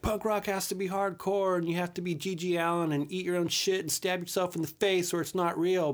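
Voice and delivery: high, loud sneering mock voice of a punk purist. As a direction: ham up the hardcore gatekeeper, pitched high and loud. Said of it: "some people will be like".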